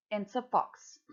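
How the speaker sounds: usual speaking voice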